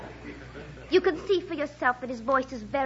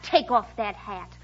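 lower, slow mock-gruff imitation; mock rough voice; 7 semitones lower, slower than usual. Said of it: "real rough like".

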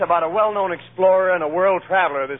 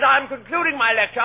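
lightly voiced lecturer, raised pitch; lecturing explorer; 6 semitones higher than usual. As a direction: address the ladies' club as a world traveler, a little higher. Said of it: "he's talking at a ladies' club".

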